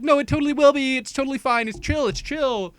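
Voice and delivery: very high, insistent mock reassuring; frantic reassurance. Girl insists everything is fine, mock reassuring. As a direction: brush it off in a high put-on voice. Breezy reassurance acted in quarrel.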